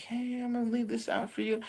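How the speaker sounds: mild mock sweet talk; voicing the wife. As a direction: coax the little creature gently. Wife coaxing the duende.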